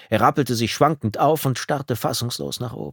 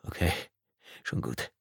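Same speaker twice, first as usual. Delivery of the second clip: soft, nearly voiceless murmur; subdued, shaken acceptance; steadying himself; 4 semitones lower, 9 dB quieter than usual. A narrator's reading. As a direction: say it low while collecting yourself. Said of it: "Tom atmete tief durch".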